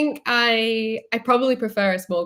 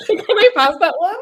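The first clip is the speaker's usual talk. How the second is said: higher and louder, laughing through the words; laughing plea; 10 semitones higher, 9 dB louder than usual. Real, not acted. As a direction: beg off the question while giggling. Dodging the Meghan or Kate question.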